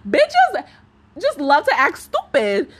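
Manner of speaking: ordinary delivery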